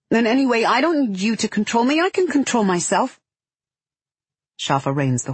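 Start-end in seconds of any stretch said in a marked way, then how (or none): 0.1-3.2 put-on high-pitched, frustrated child's retort; angry child outburst. A narrator's reading. Tired girl says more than she means to, angrily. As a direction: blurt it out, higher and defiant. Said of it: "because she's tired and angry".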